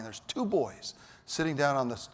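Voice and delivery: ordinary talk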